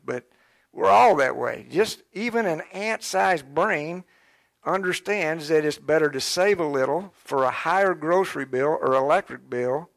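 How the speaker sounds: ordinary talk, nothing marked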